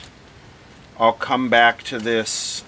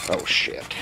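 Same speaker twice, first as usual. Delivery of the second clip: softer, unvoiced muttered oh shit; whispered curse; 3 semitones lower, 7 dB quieter than usual. Real, not acted. Vlogger watching his clip leads burn, whispered curse. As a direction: swear under your breath at the smoke.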